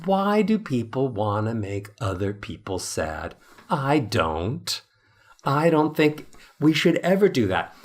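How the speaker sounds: ordinary talk, nothing marked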